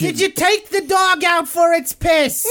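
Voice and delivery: high, drawn-out pretend scolding; hammed-up holler across the house; mock nagging spouse. Wife calls out to Frank, mock scolding yell.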